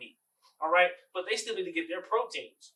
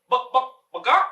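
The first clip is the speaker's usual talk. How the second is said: loud, high mock chicken clucks; chicken clucking; 8 semitones higher, 10 dB louder than usual. Teacher testing children with the wrong animal sound, chicken clucks. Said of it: "And that cow goes".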